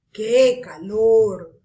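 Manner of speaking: loud, slow exclaim; a slow loud exclamation of heat. Shown as a demonstration. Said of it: "También están las exclamativas".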